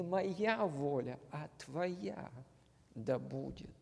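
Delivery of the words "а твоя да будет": usual speaking voice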